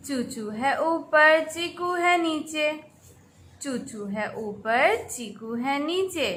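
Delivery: soft, measured sing-song rhyme; softly chanted nursery poem; children's poem recitation. Shown as a demonstration. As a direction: recite a simple rhyme for small children, line by line. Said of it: "नज़म पढ़ना शुरू करते हैं".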